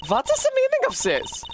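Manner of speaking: shocked. Acted. Shocked at surprise objection.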